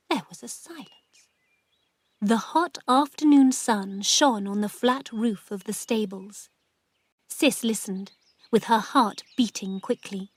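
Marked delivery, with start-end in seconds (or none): none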